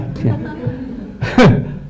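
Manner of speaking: a breathy chuckle at his own joke; quick amused laugh; short real laugh. Real, not acted. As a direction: laugh it off lightly.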